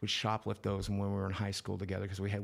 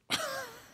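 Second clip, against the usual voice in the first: quick high burst of laughter; short laugh. Real, not acted. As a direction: laugh out at the dark joke. Laugh after the punchline.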